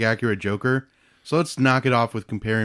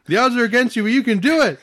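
high, loud hammed-up rallying; laughing pretend pep shout; mock cheering; 14 semitones higher, 6 dB louder than usual. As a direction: urge a fallen fighter back up. Mock cheering on pencil victim.

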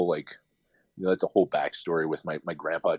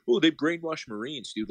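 mocking critics; 8 semitones higher than usual. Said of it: "like, they say".

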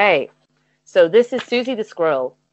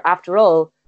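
ordinary talk

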